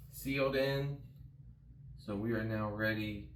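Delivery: normal talking voice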